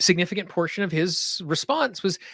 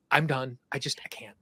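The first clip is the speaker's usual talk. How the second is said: defeated whisper; 5 semitones lower, 6 dB quieter than usual. Retold or imitated. Exhausted traveller quoted giving up.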